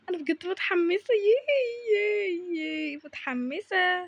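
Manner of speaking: giddy celebration alone; excited cheering. Acted. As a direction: squeal the cheer, then let it slide down. Cheering over the coming surprise.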